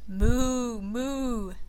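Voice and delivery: drawn-out cow moo. Acted. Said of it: "said Cow".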